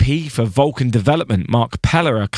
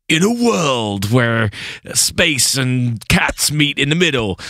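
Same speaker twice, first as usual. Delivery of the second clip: slow, drawn-out trailer narrator voice; lightly done movie trailer "in a world" read; movie trailer voiceover; 3 semitones higher, slower than usual. Pretend. Pitching a trailer voiceover to his co-host, slow and dramatic. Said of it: "you could do the voiceover for it".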